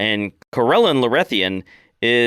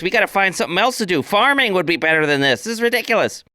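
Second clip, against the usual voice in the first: high, fast, whiny orc protest; a fretful orc pleading to give up; lanky orc arguing; 10 semitones higher than usual. Acted. Lanky orc whines to the clan, high and fast. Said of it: "lanky orc ... speaking in Orcish, and he says".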